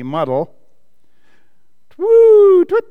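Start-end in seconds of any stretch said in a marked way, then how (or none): 1.9-2.9 very high, loud owl hoot; cross owl hoot. A narrator's reading. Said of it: "said the owl crossly".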